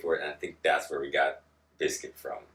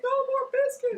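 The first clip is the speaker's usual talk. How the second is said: mock plea; 4 dB louder, slower than usual. Mock pleading cry.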